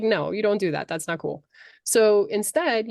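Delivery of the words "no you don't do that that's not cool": ordinary delivery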